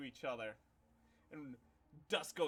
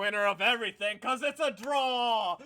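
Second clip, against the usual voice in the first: much higher, loud showman crowing; gloating announcer; 7 semitones higher, 14 dB louder than usual. Acted. Karn the arena host declaring himself winner, loud gloating. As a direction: crow over the draw to the crowd.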